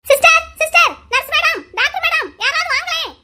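high, urgent calls for help; frantic calling out. Acted. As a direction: summon any nurse or doctor, urgently. Calling for hospital staff.

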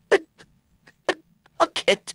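break into a high squeak. Real, not acted. Actor gives up on a line.

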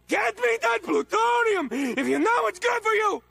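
high angry shout; threatening shout at Crash; angry threat. Acted. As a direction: bark the demand up high.

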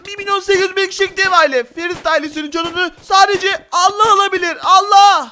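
high, shouted proud declaration; loud hammed-up warrior boast; battle cry. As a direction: bellow defiantly at the enemy. War cry at the mafia.

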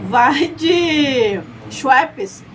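very slow, drawn-out slogan; slow slogan read. Acted. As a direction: stretch out every word like an ad. Slogan said very slowly.

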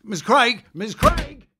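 loud and high, calling across a room; a press officer calling after the reporter. Acted. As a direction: hail her before she gets away. Chasing after the departing reporter.

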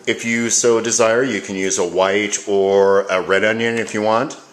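usual speaking voice

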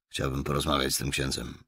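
plain reading voice

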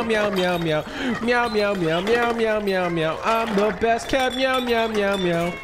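high-pitched rapid-fire meowing voice; frantic cartoon cat. Player voicing the cat as it runs away, frantic cartoon cat. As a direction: meow in a high put-on cat voice as you flee.

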